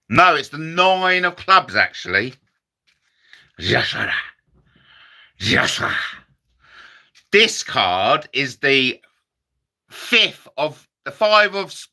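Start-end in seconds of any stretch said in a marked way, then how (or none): 3.6-6.2 low growl then whispered; mumbled Cooper catchphrase; Tommy Cooper. Retold or imitated. Comic doing Tommy Cooper's catchphrase, low. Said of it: "my Tommy Cooper impression".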